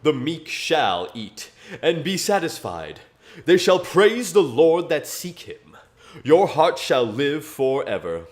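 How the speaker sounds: measured King James recital; scripture reading. Acted. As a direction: read the psalm like a sermon. Continues Psalm reading.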